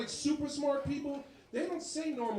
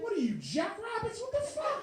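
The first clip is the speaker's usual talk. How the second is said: high, shrill-pitched disbelief; shocked dad; 10 semitones higher than usual. Retold or imitated. Dad hearing son's news, squealing high in disbelief.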